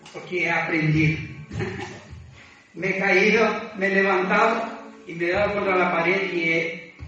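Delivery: usual speaking voice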